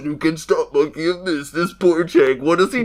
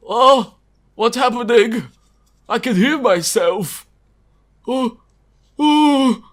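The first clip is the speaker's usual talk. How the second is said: rising, louder panicked oh's; mock panic cries; 9 semitones higher, 7 dB louder, slower than usual. Brainwashed prisoner panicking as the tape plays, rising wails. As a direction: wail as the torture kicks in. Character waking in pain.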